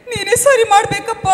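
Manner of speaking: loud, high plea to God; desperate cry to God. Acted. Mother crying out to God.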